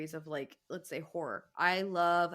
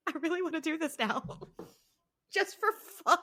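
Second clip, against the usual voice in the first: giggling; 8 semitones higher, faster than usual. Real, not acted. Giggling about doing it live.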